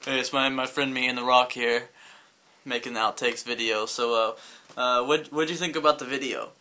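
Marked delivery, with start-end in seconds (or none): none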